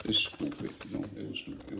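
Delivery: unvoiced, trailing off softly; quiet. Real, not acted. Guest admitting his past, dropping to breath. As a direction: let it fade to breath.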